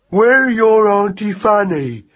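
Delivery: put-on high, slow childlike mouse voice; obedient young mouse. Mouse obeys hare's remark literally, slow and obedient. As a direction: repeat it dutifully, drawn out. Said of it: "said Magnus obediently".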